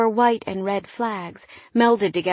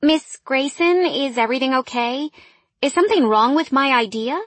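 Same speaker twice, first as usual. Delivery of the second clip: higher, louder, questioning employee voice; concerned employee; 5 semitones higher, 4 dB louder than usual. A narrator's reading. Employee checking on her daydreaming boss, concerned.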